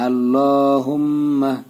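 আল্লাহুম্মাহ slowly, long "lo", humming "mm"; ghunnah and madd. Shown as a demonstration. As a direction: hold the nasal hum on the doubled m in আল্লাহুম্মাহ. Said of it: "এখানে গুন্না". (আল্লাহুম্মাহ, গুন্না আর টান দিয়ে ধীরে)

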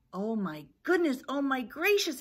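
mild storybook exclamation, rising in alarm; exclaiming. Acted. Chicken Little reacts to the falling acorn, flustered exclaiming. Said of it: "he exclaimed".

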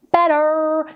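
"better" with the American r colouring the end; unsingable r vowel. Shown as a demonstration. Vocal coach showing the version that is not singable. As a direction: say "better" with a curled final r. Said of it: "it's not so singable".

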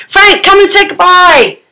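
high, loud call across the house; calling Frank to come say goodbye. Real, not acted. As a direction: call her in to say bye.

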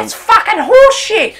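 loud, high outraged protest; outraged shout. Real, not acted. Player protesting that equal means losing, loud outraged shout. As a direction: rage at the unfair result. Lost despite equal dice.

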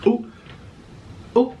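ordinary talk, nothing marked

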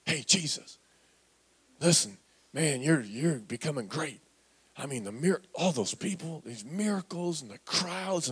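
low, soft, hushed pitch; confiding mama. Retold or imitated. Mother confiding in Jesus off to the side, hushed. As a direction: coax him quietly like a scheming mother. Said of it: "this mama just putting her arm around Jesus".

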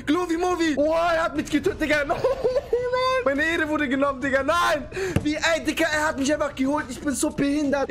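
high-pitched wailing in disbelief; dramatic, whiny gamer despair; anguished outcry. Real, not acted. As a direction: lament a humiliating game loss. Just got killed in game.

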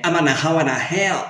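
words slurred into one another; drunk rowdy Scottish singer. Retold or imitated. Teacher imitates AC/DC's singer for learners, drunken slur. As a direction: mash the words together like a drunken rocker. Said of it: "más fácil que".

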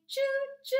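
high, loud choo-choo; sung train whistle. Shown as a demonstration. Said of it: "here's how it goes".

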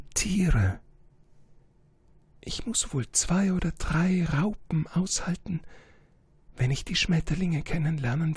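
high, delicate voice with hushed whispered lines; a proud, fragile flower saying goodbye. Acted. Flower's long speech.

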